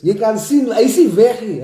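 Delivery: ordinary delivery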